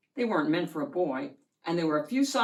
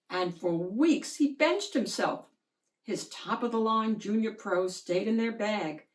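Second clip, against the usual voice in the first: ordinary delivery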